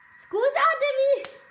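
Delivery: high, loud, drawn-out cry of apology; excited, flustered apology. Real, not acted. Cook apologising to live viewers for first-time fumbles, excited and loud. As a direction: sing out the apology at the top of your voice.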